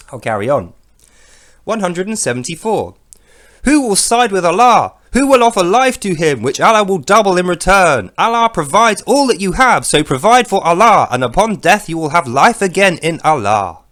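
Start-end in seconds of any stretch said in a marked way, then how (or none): 3.6-13.8 loud, declaiming scripture reading; booming mock sermon; mock preaching. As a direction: proclaim it to the crowd. Reading verse as proclamation.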